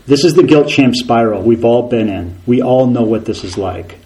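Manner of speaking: ordinary delivery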